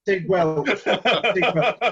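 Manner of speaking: laughing while speaking. Real, not acted. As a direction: chuckle through the words. Laughing at accent mix-up.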